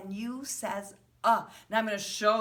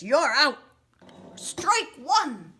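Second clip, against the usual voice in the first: loud, high "You're out. Strike one"; umpire call; 8 semitones higher, 9 dB louder, slower than usual. Retold or imitated. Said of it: "An umpire says".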